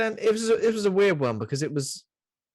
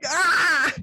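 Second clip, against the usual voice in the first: mock yell; 13 semitones higher, 4 dB louder than usual. Mock stabbing.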